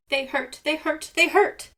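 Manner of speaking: high wailing complaint; crying in pain. A narrator's reading. Seagull hopping on sore feet, high pained wail. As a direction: whimper it high and loud. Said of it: "cried Seagull".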